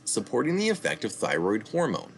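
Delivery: ordinary talk, nothing marked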